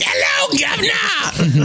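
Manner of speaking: high, drawn-out Cockney shout; over-the-top Cockney squawk; mock Cockney. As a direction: holler it like a cartoon Cockney. Mock cockney greeting as example.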